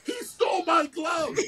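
high, outraged retold shout; indignant exclaim. Realizing his teammate took his glove, a high indignant mock shout. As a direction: shout it like you just caught the thief.

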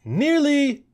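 very high squeaky reply; squeak. Acted. Anika answers the cousin with a high squeak. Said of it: "squeaked Anika".

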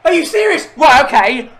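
ordinary talk

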